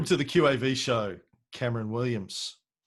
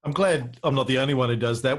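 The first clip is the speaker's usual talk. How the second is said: normal talking voice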